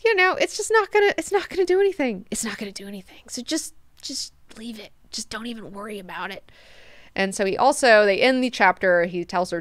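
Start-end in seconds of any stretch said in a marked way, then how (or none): 0.0-1.1 high put-on voice. Advising himself to drop Twitter fights. 1.2-2.3 much higher, quick and light; high put-on voice. Telling himself Twitter fights are pointless, high and quick. 2.3-3.2 hushed whisper, no voice. Acted. Telling himself Twitter fights are pointless, whispered. 3.3-6.5 whisper. Acted. Advising himself to drop Twitter fights.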